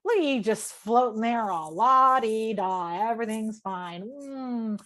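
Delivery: mock airy contentment with a bitter edge; sarcastic la-di-da. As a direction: sneer at someone floating about carefree. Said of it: "That was the energy I was sending him".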